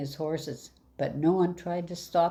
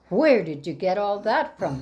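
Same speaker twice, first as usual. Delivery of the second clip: loud, greedy demand; a louder, insistent question; 5 semitones higher, 6 dB louder than usual. Acted. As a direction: press him hard for the answer. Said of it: "he demanded".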